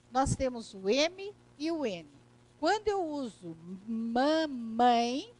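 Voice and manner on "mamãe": mamãe with long, hummed Ms; drawn-out M sounds in mamãe; M pronounced in mamãe. Shown as a demonstration. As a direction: linger slowly on each M of mamãe. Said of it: "eu estou pronunciando esse obstáculo".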